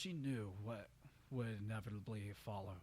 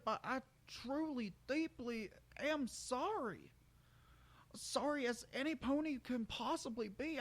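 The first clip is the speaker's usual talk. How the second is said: remorseful country stallion; 14 semitones higher, 3 dB louder than usual. Acted. Brayburn apologizing to Roseluck, high and remorseful. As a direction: plead sorry from the heart.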